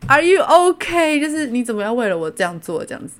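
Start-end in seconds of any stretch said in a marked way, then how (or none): 0.1-2.2 alarmed concern. Retold or imitated. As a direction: ask in shocked worry. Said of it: "I was like". (高声惊慌地关心)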